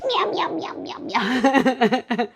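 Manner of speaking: high playful meows like a cat; mock meowing. The body reacting to trauma talk, high mock meows. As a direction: meow up high, teasing the body's alarm. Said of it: "your body goes".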